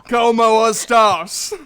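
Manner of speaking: loud, high, slow hammed-up Spanish with Aussie twang; mock half-Spaniard greeting; Duke's Aussie-Spanish accent. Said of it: "Duke being half Spaniard".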